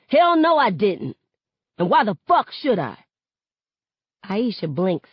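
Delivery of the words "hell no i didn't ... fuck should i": loud, higher, scornful; defiant shout. Acted. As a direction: throw it back defiantly.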